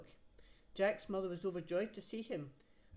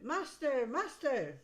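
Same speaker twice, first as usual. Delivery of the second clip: high, loud alarmed call; harp crying out; 7 semitones higher, 6 dB louder, slower than usual. Acted. Golden harp calling the sleeping giant, high alarmed cry. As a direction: cry out for the master. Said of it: "the harp cried out".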